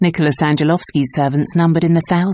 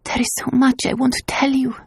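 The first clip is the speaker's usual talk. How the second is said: muffled; 8 semitones higher than usual. A narrator's reading. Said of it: "her voice muffled".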